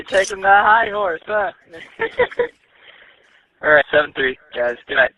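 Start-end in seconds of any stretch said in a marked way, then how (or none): none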